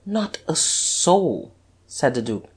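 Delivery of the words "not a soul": drawn out slowly. A narrator's reading. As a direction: let it hang, very slow. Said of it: "said Guerchard slowly".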